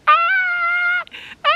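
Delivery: high, long mock scream; imitated kid scream. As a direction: imitate a child screaming down a slide. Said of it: "She's like".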